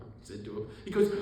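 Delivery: ordinary talk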